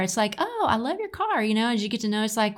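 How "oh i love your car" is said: high, gushing admiration; gushing compliment. Pretend. Woman admiring her date's new car, high and gushing. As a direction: gush over it in a high voice.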